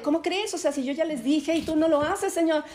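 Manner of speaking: high, loud indignant outburst; angry Jonah. Acted. Jonah protests to God that Nineveh was spared, high indignant shout. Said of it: "el Jonás bien enojado".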